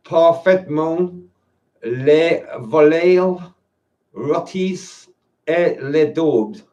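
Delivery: very slow, laboured amateur French accent; rough French accent. Shown as a demonstration. Non-speaker reading a wine label's food pairing, approximate French accent. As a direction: sound out each French word slowly in an English-tinged accent. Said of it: "my best approximation of a French accent".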